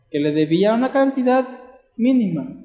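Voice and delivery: normal talking voice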